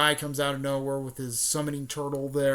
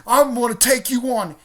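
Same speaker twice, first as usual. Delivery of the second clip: loud, high mock battle challenge; acted shout, pitched way up; 10 semitones higher, 12 dB louder than usual. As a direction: belt it out like an eager challenger. Naruto challenges rival in retold story.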